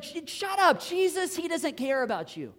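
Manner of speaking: high, loud acted rebuke; hammed-up dismissive yell; scolding crowd. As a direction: shout them down like an annoyed bystander. Bystanders scold the blind men again.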